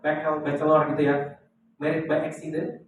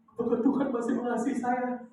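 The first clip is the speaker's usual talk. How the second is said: mock tearful; 7 semitones higher than usual. Fallen churchgoer asks pastor whether God still loves her, mock tearful. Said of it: "nangis, nangis".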